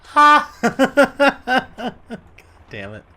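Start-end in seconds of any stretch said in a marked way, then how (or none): 0.0-2.0 loud, high burst of laughing; burst of laughter. Real, not acted. Gamer cracking up at a fish pun, laughing.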